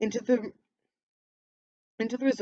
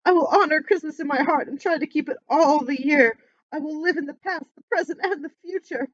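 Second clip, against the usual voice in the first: higher, louder earnest promise; fervent vow; 7 semitones higher, 6 dB louder than usual. Acted. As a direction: swear it with all your heart. Scrooge vows to the spirit.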